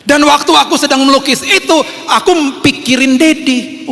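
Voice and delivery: put-on high, drawn-out kid voice; child's voice. Child telling Daddy of thinking of him, high earnest voice. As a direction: stretch it out like a devoted child.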